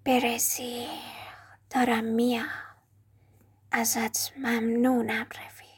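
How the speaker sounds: slow, hushed snake whisper; grateful whispered farewell; soft whisper. A narrator's reading. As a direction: breathe it out secretly as it slides past. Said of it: "صدای آهستهٔ او را شنید".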